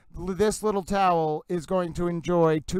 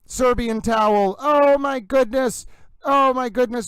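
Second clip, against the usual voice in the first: delighted exclamation; 5 semitones higher, 5 dB louder than usual. Acted. Delighted by a Serbian viewer.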